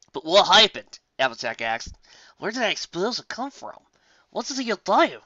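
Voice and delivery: put-on worried farm girl. A narrator's reading. Applejack voiced.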